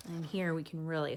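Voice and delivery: ordinary delivery, nothing marked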